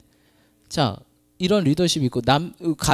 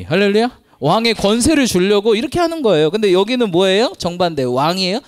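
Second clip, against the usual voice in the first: high, loud preaching; full-force preaching; 7 semitones higher, 7 dB louder than usual. Acted. Pastor preaching kingship to the congregation, loud and high. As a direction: proclaim it to the whole church.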